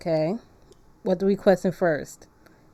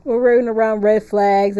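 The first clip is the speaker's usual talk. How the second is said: loud hype; 3 semitones higher, 7 dB louder than usual. Real, not acted. Hyped riff with chat.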